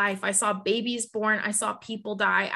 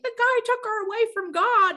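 gossipy mock voice; 12 semitones higher, 4 dB louder than usual. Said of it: "everybody probably was like".

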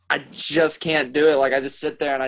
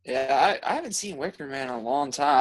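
ordinary talk, nothing marked

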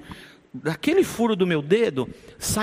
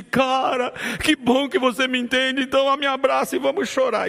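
tearful invitation; 6 semitones higher than usual. Retold or imitated. Grieving pastor inviting the brother to cry together, higher tearful. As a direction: offer the hug through tears. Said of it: "eu chorando de saudade".

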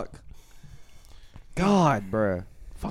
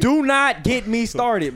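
slow, deliberate, each word separated; exasperated warning; much slower than usual. Real, not acted. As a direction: stretch it out as a final warning. Warns friend off the topic.

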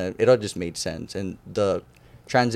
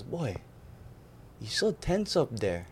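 a hint of a laid-back coach settling a nervous kid; calm coach; 5 semitones higher, 11 dB quieter than usual. Coach telling a tense freshman to relax, soft and calm.

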